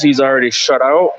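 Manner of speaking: ordinary talk, nothing marked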